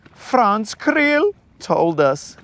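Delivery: hammed-up foreign wedding planner; Franz. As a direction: stretch it slowly in a put-on high accent. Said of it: "Creel is Franz".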